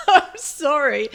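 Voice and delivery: high, loud, slow laughing apology; drawn-out sorry, still laughing. Real, not acted. Apology straight after laughing.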